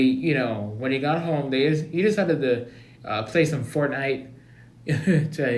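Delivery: ordinary delivery, nothing marked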